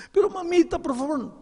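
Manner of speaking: very high, pleading whine; pleading child. Acted. Boy begging his mother to spare him, very high plea. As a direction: beg like a scared boy.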